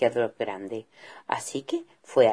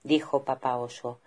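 ordinary delivery

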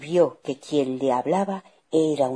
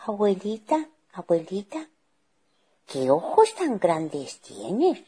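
put-on higher child voice, puzzled; puzzled little girl; 5 semitones higher than usual. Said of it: "Extrañada le dijo".

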